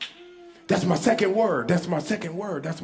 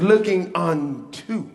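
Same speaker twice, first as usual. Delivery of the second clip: slow reading; 3 semitones lower, 3 dB quieter, slower than usual. Shown as a demonstration. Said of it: "Listen, it says".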